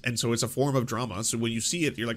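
usual speaking voice

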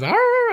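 high yappy growl; jealous dog growl. Retold or imitated. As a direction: make a jealous little dog noise. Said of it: "Henry was all jealous".

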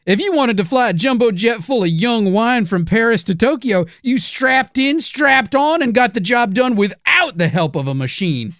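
put-on high, cranky old-timer voice; old prospector. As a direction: grumble like a crusty old prospector. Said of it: "you young whippersnappers".

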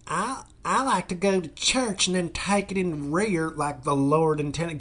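lightly voiced prim church lady; mock pious conservative woman. Voicing a churchgoing GOP woman.

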